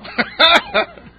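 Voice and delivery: loud high burst of laughter; laughing at own punchline; real laugh. Real, not acted. Preacher laughing at his own joke to the congregation.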